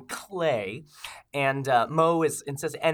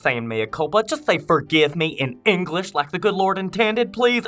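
loud, bright commercial announcer; ad read; 2 semitones higher, 6 dB louder than usual. Acted. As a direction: sell it like a radio ad. Ad read over music.

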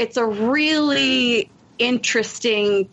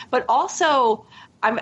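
ordinary talk, nothing marked

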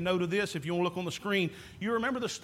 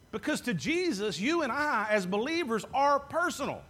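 usual speaking voice

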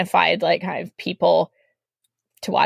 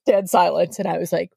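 ordinary delivery

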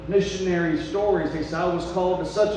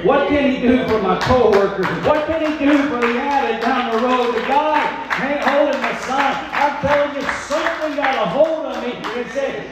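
raised, higher preacher's fervor; impassioned preaching; 7 semitones higher, 6 dB louder than usual. Real, not acted. Preacher testifying to the congregation, impassioned. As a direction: preach it with building conviction.

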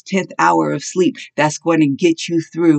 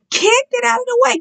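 high, full-force preaching cry; fiery preaching. Acted. Preacher urging the congregation to let God shake them, in a fiery high cry. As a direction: preach it out at the top of the voice.

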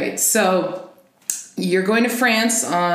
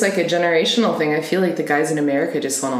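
ordinary talk, nothing marked

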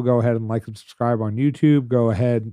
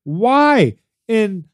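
loud, higher exasperated rant, slow; mock rant; 6 semitones higher, 3 dB louder, much slower than usual. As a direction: vent at the app like it wronged you. Ranting at Peacock.

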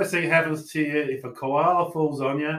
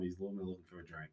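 whispered aside; 4 semitones lower, 15 dB quieter, faster than usual. Real, not acted. Trailing aside about koalas.

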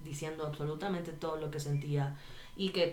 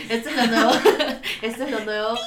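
giggling through a mocking imitation of the brand; cracking up while mimicking the brand; laughing mock pitch; 6 semitones higher, 11 dB louder, faster than usual. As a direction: laugh as you parrot their pitch.

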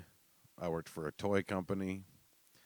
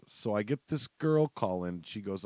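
ordinary talk

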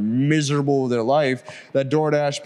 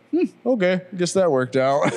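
higher-pitched, amused concession; retold pleasant surprise; 9 semitones higher than usual.

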